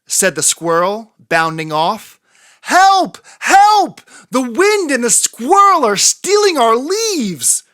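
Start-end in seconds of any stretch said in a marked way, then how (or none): 2.6-7.6 high, loud cry for help. A narrator's reading. Little fox shouting for help as squirrel takes leaves. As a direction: call out in alarm. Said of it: "cried Fletcher".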